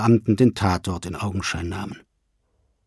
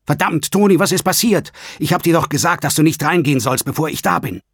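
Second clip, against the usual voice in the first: urgent, raised voice, pitched high; higher-pitched, demanding shout; 7 semitones higher, 3 dB louder than usual. A narrator's reading. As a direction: shout to get through to him. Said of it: "Er musste schreien".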